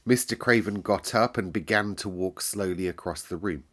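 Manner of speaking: ordinary delivery, nothing marked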